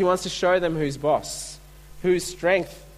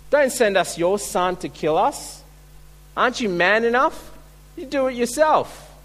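mocking dare; 6 semitones higher than usual. Kings daring Gideon.

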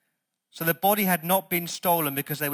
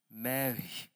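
hushed, gentle retold address; tender name-calling; 3 semitones lower, 8 dB quieter than usual. Preacher repeats Jesus saying her name, tender and soft. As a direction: speak the name softly, letting it land.

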